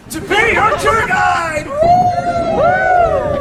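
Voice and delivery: high, slow, booming hype; rally the crowd with a whoop; excited whoop. Acted. Hypes crowd for sound effects.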